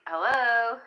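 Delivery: loud announcing hello. Acted. Tarot card calling for attention, loud hello. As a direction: call out like an announcement.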